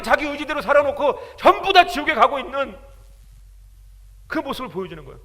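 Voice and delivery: loud, high, impassioned sermon; fiery preaching. Acted. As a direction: preach it with full fervor. Preaching at full force.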